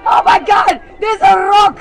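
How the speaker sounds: loud, high alarmed shouts; panicked shouting. Acted. A worker warns the president about a big rock, panicked shouts. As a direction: warn him in a panic.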